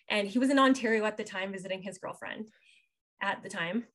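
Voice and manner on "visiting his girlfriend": usual speaking voice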